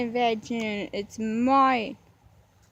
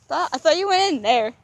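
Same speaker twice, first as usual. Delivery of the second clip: loud, raised call; loud relieved call; 4 semitones higher, 10 dB louder than usual. Real, not acted. Spotting the dog again, calling out loudly.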